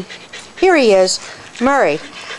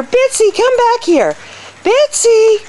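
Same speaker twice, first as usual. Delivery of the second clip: high urgent call to a wandering dog; calling dog back; 14 semitones higher than usual. Real, not acted.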